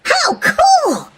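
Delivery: put-on high delighted squeal of a child; bright high kid's delight; excited. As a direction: sound thrilled at the find. Willy finds the treasure map.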